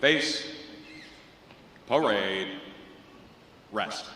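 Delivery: low, slow, stretched commands; drawn out parade commands. Real, not acted. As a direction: call each command out slowly.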